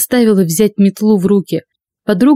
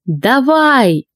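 shout; 5 semitones higher than usual. A narrator's reading. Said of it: "закричала".

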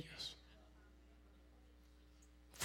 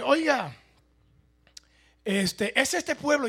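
louder, higher hail; calling out; 6 semitones higher, 7 dB louder, slower than usual. Acted. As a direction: call out to the house. Preacher calls at a house. (llamando en voz alta)

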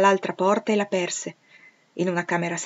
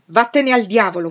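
louder, higher, curt curse; angry retort; 4 semitones higher, 9 dB louder than usual. A narrator's reading. Rochester cursing his brother-in-law, angry. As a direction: fling it at him in contempt. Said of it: "fu l'augurio che gli lanciò".

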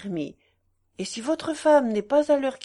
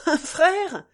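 higher, louder, mocking sneer; insulting irony; 7 semitones higher, 5 dB louder than usual. A narrator's reading. Husband mocking his wife's claim, ironic. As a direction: throw her word back with scorn. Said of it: "avec le même ton d'ironie insultante".

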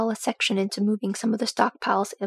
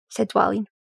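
usual speaking voice

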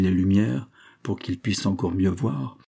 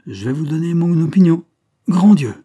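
éclat fort et aigu; exclamation explosive; 8 semitones higher, 10 dB louder than usual. A narrator's reading. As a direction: exploser de stupeur devant la vitre. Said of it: "explosa le substitut". (explosion de voix forte et aiguë)

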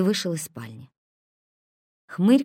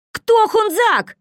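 outraged; 9 dB louder, much slower than usual. A narrator's reading. Косой outraged.

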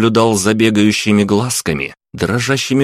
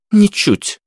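curt, high-pitched refusal; sharp dismissive woman's retort; cutting retort; 13 semitones higher than usual. A narrator's reading. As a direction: cut the question dead. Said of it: "отрезала рыжая".

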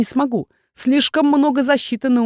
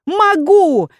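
much higher, louder second cry; stronger confident shout; 9 semitones higher, 5 dB louder than usual. Acted. Heroine repeats her defiance, even louder. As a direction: push it harder, defiant. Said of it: "стараясь кричать как можно увереннее".

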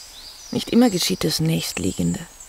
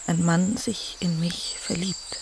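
slow, hushed whisper; whispered question; 5 dB quieter, slower than usual. Acted. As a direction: barely dare to ask it. Hesitant intimate question to husband.

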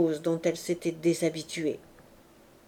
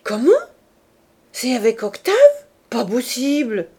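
surprised exclaiming; 3 semitones higher, 7 dB louder than usual. A narrator's reading. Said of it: "en criant".

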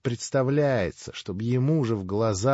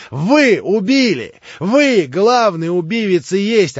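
wheedling lackey turning to loud reproach; Smerdyakov accusing; 6 semitones higher, 10 dB louder than usual. Acted. Smerdyakov accusing Ivan, building.

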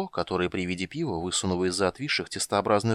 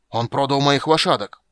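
loud, higher-pitched furious outcry; boiling over; 5 semitones higher, 8 dB louder than usual. A narrator's reading. Halfling tells the others his horses were sold, seething. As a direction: burst out with indignation. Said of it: "вскипел Низушек".

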